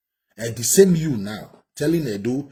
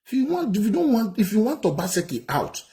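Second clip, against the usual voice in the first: furious high scolding; 6 semitones higher, 3 dB louder than usual. Real, not acted. Commentator scolds the politician in the clip.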